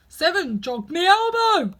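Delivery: slow, loud sulky grumble. Acted. Five grumbles blaming Seven, slow and loud. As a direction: drag out the blame. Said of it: "said Five in a sulky tone".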